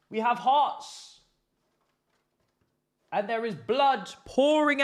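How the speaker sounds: high, loud and slow, a hammed-up tragic wail; melodramatic lament. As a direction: mourn the loss like a tragedy on stage. Dramatic lament over losing.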